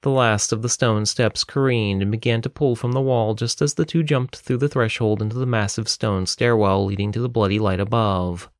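ordinary delivery, nothing marked

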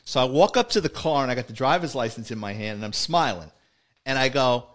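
usual speaking voice

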